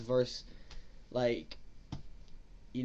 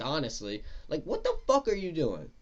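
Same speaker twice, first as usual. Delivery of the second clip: ordinary talk, nothing marked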